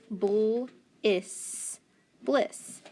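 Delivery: bull is stretched slowly, then blended into bliss; slow blending. Shown as a demonstration. Teacher slowly blending a first syllable for the class. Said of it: "blend that B L together".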